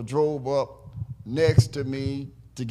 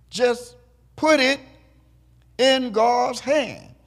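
higher and louder, each word of "put it in God's hand" hammered slowly; slow rising pulpit punch on "God's hand"; preaching emphasis; 7 semitones higher, 5 dB louder, slower than usual. Acted. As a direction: drive the theme home from the pulpit. Preacher drives home his theme.

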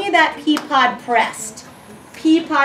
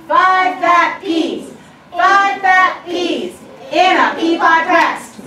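higher and louder, lively poem reading; expressive phrasing; 5 semitones higher, 4 dB louder than usual. Shown as a demonstration. Teacher leading the class in the final reading, lively and expressive. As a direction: perform it with lots of energy. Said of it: "with our beautiful phrasing".

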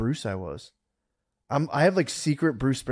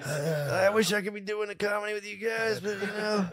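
higher, softer fake-modest guy; mock humble worker; 8 semitones higher, 6 dB quieter than usual. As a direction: play the humble working man. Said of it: "I was like".

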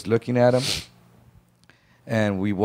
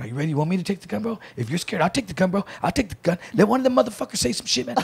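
higher, egging-on voice with a laugh; hyped-up Mark; 8 semitones higher, faster than usual. Retold or imitated. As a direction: taunt your buddy, grinning and pushed up high. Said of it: "And Mark's going".

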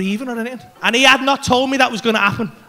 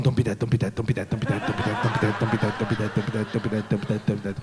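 low, soft, rapid pleading under the breath; retold anxious mantra; 10 dB quieter, faster than usual. Comedian re-enacting his wait over an unresponsive friend, anxious low mantra. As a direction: chant it quietly like a nervous prayer. Sat waiting, fears friend is dead.

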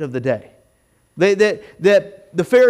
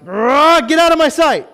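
raised, higher voice, tossing someone out; mock angry outburst; 8 semitones higher, 5 dB louder than usual. Pastor mimicking a short-tempered person throwing someone out, mock yell. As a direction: bark it out in mock rage. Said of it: "we're just like".

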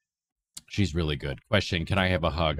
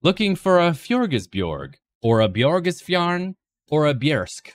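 mock Swedish; 8 semitones higher, 4 dB louder than usual. Mock Swedish furniture names.

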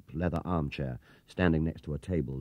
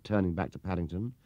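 usual speaking voice

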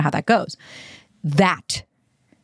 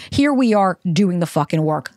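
ordinary delivery

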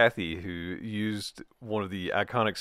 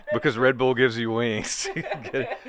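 usual speaking voice

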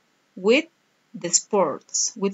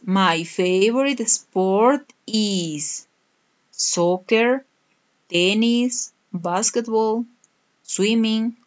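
slow model answer; much slower than usual. Shown as a demonstration. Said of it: "Okay, the answer is".

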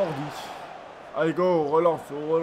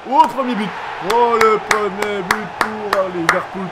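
louder, slower, drawn-out goal call; excited goal cry; 3 semitones higher, 6 dB louder than usual. Real, not acted. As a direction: celebrate the goal like a commentator. Celebrates the first goal. (cri de but enthousiaste, plus fort et traînant)

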